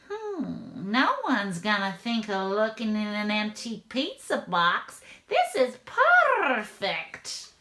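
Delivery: crafty villain hatching a plan; scheming cat burglar. Acted. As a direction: purr the last word with relish.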